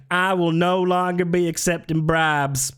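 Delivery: mock politician's proclamation; Ted Cruz. Mocking a politician confessing to bribes, declaimed. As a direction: declare it grandly in a put-on voice. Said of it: "And Ted Cruz like".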